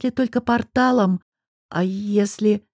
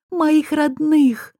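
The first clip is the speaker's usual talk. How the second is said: anguished cry; 7 semitones higher, much slower than usual. A narrator's reading. Nayra crying out in despair.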